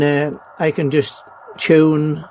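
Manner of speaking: ordinary talk, nothing marked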